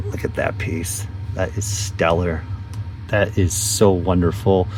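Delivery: hushed awe. Real, not acted. Admiring his childhood box.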